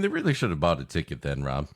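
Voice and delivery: ordinary delivery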